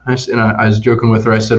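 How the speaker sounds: ordinary delivery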